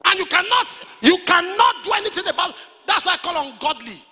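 high, loud, hammered exhortation; full-force preaching. Real, not acted. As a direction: press the command on the congregation.

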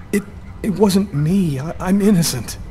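high, slow, pleading in panic; panicked. Acted. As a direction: beg them to believe you. Suspect pleads innocence, panicking.

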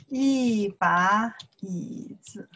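slow, careful teacher demo; slow model reading. Shown as a demonstration. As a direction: spell the phrase out slowly for learners. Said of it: "Then you know why I use the".

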